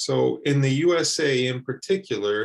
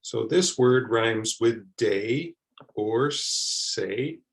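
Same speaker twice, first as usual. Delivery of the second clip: slow, deliberate teaching pace; slow explanation; much slower than usual. Shown as a demonstration. Teacher slowly explaining a vowel to learners. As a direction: spell out each word slowly. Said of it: "say the A like the letter itself".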